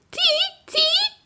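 very high, rising bird shriek; blackbird alarm screech. A narrator's reading. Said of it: "schrie sie".

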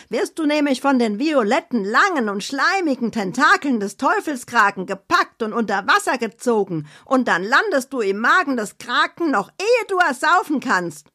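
high, dramatic spooky storytelling voice; Annabella's scary tale. Acted. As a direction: relish the horror in a high voice, slowing at the end. Annabella's character voice.

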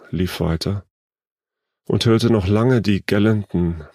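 ordinary delivery

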